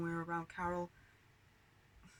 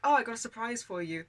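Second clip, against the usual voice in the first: imitated aunt, higher and louder; excited announcement; 5 semitones higher, 8 dB louder than usual. Aunt to cousin after dinner, excited. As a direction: sing out a surprise for a child.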